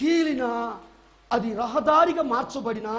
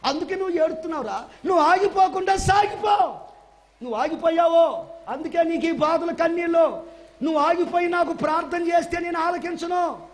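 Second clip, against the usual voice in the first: loud, higher sermon voice pressing on; fiery preaching; 4 semitones higher, 4 dB louder than usual. Acted. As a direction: preach it hard to the congregation. Preaching at full force.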